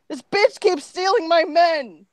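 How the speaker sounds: high, loud sulky complaint; pouting. Acted. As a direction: whine it with a pout. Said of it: "Gaming pouted".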